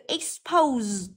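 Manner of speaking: expose ending on a long voiced z; buzzing z sound. Shown as a demonstration. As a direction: draw out the z closing expose. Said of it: "được phát âm là z". (phát âm expose với âm z rung ở cuối)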